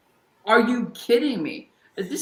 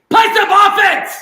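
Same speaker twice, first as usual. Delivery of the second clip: much higher, much louder, slow scream; furious shout; 14 semitones higher, 14 dB louder than usual. Real, not acted. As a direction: bellow it at the team with everything. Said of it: "let me give your voice a little, break".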